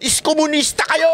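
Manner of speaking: high mocking echo of the insult hurled at them. Echoing what angry supporters called them, high mocking taunt. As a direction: parrot the name-calling in a high mocking voice. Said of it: "minura nyo kami lahat".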